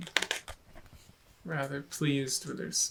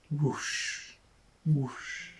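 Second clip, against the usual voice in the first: whispered whoosh; 3 dB quieter than usual. Acted. Wave sound effect.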